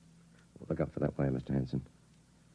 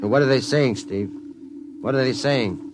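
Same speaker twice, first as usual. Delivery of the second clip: loud, higher, eager demand; urgent questioning; 8 semitones higher, 6 dB louder than usual. Acted. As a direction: get the words out of him now. Hypnotist presses the entranced pilot.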